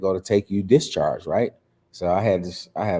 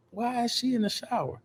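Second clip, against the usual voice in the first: sleepy puzzled; 8 dB quieter than usual. Retold or imitated.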